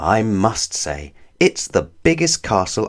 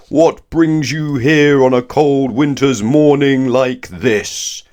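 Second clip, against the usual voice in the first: loud, slow, higher thunder of a giant; 6 semitones higher, 5 dB louder, slower than usual. Acted. Giant wizard thunders a question at his small visitor. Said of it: "thundered Wizard Winterbottom".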